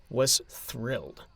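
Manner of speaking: plain reading voice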